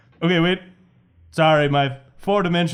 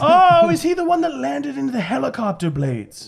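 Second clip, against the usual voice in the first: high-pitched, delighted recognition; gleeful villain; 10 semitones higher, 4 dB louder than usual. Acted. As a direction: revel in a wicked memory, pitched way up. Gleeful villain recalls a killing.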